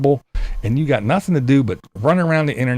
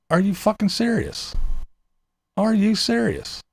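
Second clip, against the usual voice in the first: incredulous disbelief; 6 semitones higher, 4 dB quieter than usual. Retold or imitated. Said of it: "shake your head and go".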